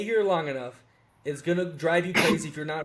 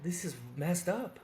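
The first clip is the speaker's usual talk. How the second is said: slow and much softer, fed up; muttered disgust; 7 dB quieter, slower than usual. Retold or imitated. Expat telling a friend how Brazil wears on him, softly fed up. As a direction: let it sink in quietly, worn down by the place. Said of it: "you're like".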